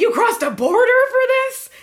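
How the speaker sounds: mock-astonished, high and loud question; incredulous. Promoter to fan who drove eight hours, incredulous. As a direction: imitate a dumbfounded promoter, pitch shooting up. Promoter's disbelief at her long drive.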